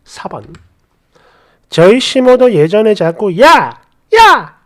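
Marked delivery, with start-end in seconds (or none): 3.4-4.6 loud, higher mock summons; retold calling out. Mother-in-law repeatedly calling her daughter-in-law, mock yell. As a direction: call out sharply, imitating a mother-in-law.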